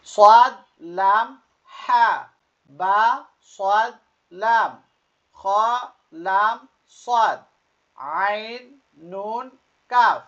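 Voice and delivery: letter names called out loud, high and elongated; letter-by-letter recitation. Shown as a demonstration. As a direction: lead learners through each letter, pausing after it. Leads learners reading along. (জোরে টেনে টেনে হরফ পড়া)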